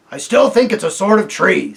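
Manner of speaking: dull, stubborn warthog. A narrator's reading. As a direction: insist stubbornly. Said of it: "said the warthog".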